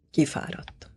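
soft, breath-only murmur; subdued echo. A narrator's reading. Father sadly agreeing his son is worn out, hushed. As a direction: echo it quietly, resigned. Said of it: "mondotta ő is".

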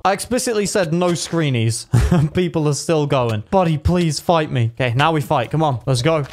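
ordinary talk

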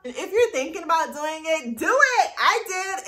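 high, loud encouragement; bursting cheer; excited urging. Real, not acted. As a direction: push them to just do it. Urging viewers to start.

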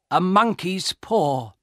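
slow, emphatic soldier's statement, falling at the end; name it slowly and gravely; solemn reveal. A narrator's reading.

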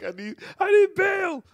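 high, loud, laughing shout for bail; laughing plea. Pretend. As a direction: beg for bail while cracking up. Pleading from jail, laughing.

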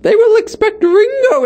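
high put-on villain voice, dropping on the last word; Bane. Co-host answering who the villain is, high villain voice. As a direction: proclaim it high like a film villain. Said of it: "That was my Bane voice".

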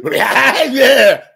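high, loud hammed-up giggle; mock fake giggle. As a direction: imitate a phony host's laugh. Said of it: "mimicking somebody".

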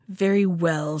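low, grudging assent; sulky. A narrator's reading. Shark giving in to the monkey, sulky. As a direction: give in with a low-pitched sulk. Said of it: "said the shark sulkily".

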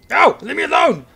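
high, loud yell; panicked shout. Real, not acted. Attacked by enemy, yelling.

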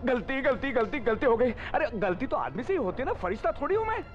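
high-pitched, repetitive pleading; desperate high-voiced apology; panicked excuse. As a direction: plead your way out, voice climbing. Cornered man excuses himself, pleading.

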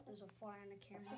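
quick quiet aside about the fly; muttered aside. Real, not acted. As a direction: mumble it to yourself. Repeats fly remark.

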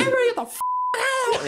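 very high mock mom yell; shrieky imitation of a frantic mom; mom mode. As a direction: imitate a mother barging through in a panic. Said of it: "she went mom mode".

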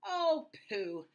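high, loud, playful dismissal; amused scoff. A narrator's reading. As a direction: brush it off as the little mouse. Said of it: "The mouse laughed".

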